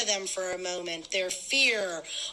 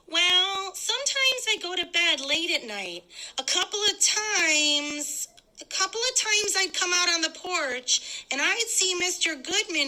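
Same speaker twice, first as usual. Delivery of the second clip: higher woman's voice, telling a secret suspicion; suspicious woman; 8 semitones higher than usual. Acted. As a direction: raise the voice to a nosy neighbor woman. Woman tells what she saw.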